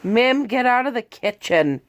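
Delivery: loud, high scolding call; calling out to the dog. Real, not acted. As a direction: shout the dog out of the kitchen.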